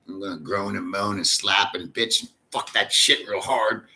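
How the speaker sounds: normal talking voice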